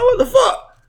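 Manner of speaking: very high, incredulous outburst; retold shock. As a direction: replay your disbelief, pitch leaping.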